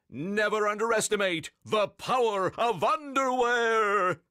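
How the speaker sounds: high heroic cry, last word held long; Captain Underpants. A narrator's reading. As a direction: proclaim it like a pompous superhero. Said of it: "cried Captain Underpants".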